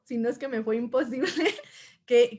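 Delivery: normal talking voice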